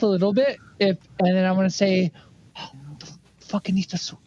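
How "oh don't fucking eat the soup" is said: low, hushed aside; secretive warning. Acted. Dago warning John away from the others, hushed. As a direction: keep it down so only he hears.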